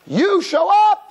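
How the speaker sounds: high, loud scolding; Hashem's heavy rebuke. Acted. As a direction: burst out in loud, high-pitched reproof. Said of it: "heavy, heavy musar".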